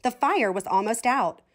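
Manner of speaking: usual speaking voice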